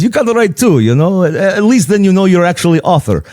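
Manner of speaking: high, animated Greek impression; Greek accent. Father-in-law urges a second book, Greek accent. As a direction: advise in a put-on Greek accent. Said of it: "my best Greek impression".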